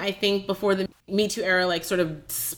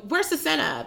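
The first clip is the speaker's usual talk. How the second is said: impatient demand; 7 semitones higher than usual. Retold or imitated. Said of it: "I'm, like, so annoyed".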